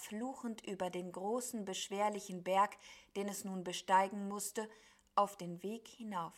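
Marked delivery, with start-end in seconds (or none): none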